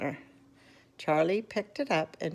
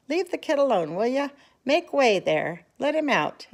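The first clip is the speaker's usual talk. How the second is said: loud, high crowd clearing; gruff shopkeeper; 7 semitones higher, 5 dB louder than usual. A narrator's reading. Shopkeeper pushing the crowd back from Charlie, loud. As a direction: bark the crowd away. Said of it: "the fat shopkeeper said".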